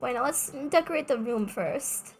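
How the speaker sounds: normal talking voice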